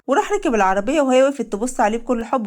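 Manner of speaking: normal talking voice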